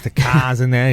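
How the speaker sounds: lightly attempted Boston accent, dropped R's; bad Boston accent. Shown as a demonstration. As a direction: poke fun with a rough Boston accent. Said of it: "really bad version of a Boston accent".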